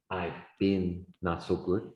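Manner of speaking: mildly gloomy answer; low, glum reply. Shown as a demonstration. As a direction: sound a bit down. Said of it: "bad or just a little bad".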